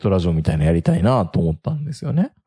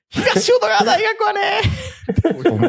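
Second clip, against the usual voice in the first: laughing; much slower than usual. Real, not acted. Laughing at a joke.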